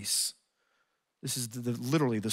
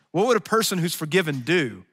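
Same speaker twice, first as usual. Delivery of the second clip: louder, higher rhetorical question; raised preaching question; 4 semitones higher, 5 dB louder than usual. Real, not acted. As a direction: fling the question out to the room. Rhetorical question, loud take.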